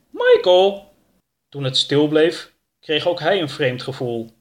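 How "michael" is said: high, loud, drawn-out call. A narrator's reading. Oroka calling for Michael by the front door, calling out. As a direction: shout the name to someone upstairs. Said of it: "riep Oroka een paar keer".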